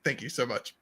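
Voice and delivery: low, soft Obama sign-off; Obama impression. Podcaster ends Obama demo on thanks. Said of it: "He does that".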